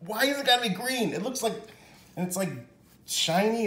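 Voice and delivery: baffled. Real, not acted. Baffled by green bun.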